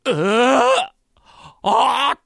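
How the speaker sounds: loud, high yelp of horror; startled scream. Acted. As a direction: cry out at a dreadful discovery. Servant discovers the master is back.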